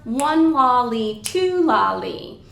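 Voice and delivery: slow, even "one lolly, two lolly"; triplet count. Shown as a demonstration. Teacher showing another way to count triplets, slow and rhythmic. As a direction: count in three equal syllables per beat, slowly. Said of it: "we typically count triplets either".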